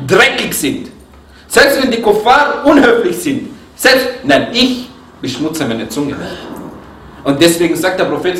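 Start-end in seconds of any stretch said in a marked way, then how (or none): none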